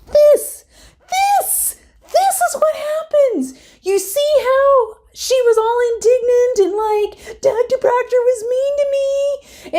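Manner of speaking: gleeful, almost shrieking with delight; high thrilled gossip voice; excited rant. Real, not acted. Excited rant about the call-out.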